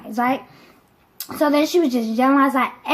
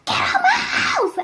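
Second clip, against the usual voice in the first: very high, drawn-out mock scream; imitated screaming mom; slower than usual. Mom throwing auntie out, mock screaming. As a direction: screech it like a mother in a rage. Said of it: "she's like".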